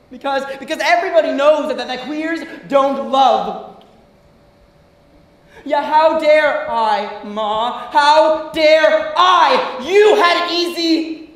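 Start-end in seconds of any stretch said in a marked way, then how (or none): 5.7-11.3 slow, building to a loud shout; outraged reproach. Acted. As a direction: stretch each word and let the anger rise. Acted quarrel with mother escalates.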